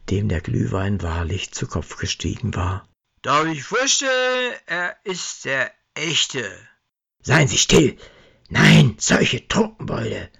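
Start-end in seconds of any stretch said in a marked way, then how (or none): 3.2-6.5 tipsy introduction. Acted. Drunk Herbie introduces Santa. 7.2-10.3 an enraged department head shouting them down; angry rebuke. Acted. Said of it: "zischte der erboste Leitungskader".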